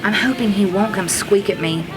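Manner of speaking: plain reading voice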